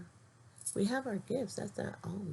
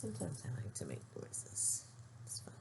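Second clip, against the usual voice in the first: breathy whisper, much softer; 5 semitones lower, 10 dB quieter than usual. Real, not acted. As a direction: whisper it like a secret. Whispered aside to camera.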